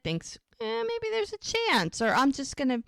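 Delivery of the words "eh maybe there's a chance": very high, shrugging "Eh, maybe there's a chance"; a lightly voiced hopeful suitor; hopeful guy's thought. Voicing Cameron's hopeful thought.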